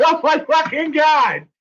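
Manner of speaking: high, laughing cry of disbelief; stunned disbelief. Retold or imitated. Acts out own stunned reaction.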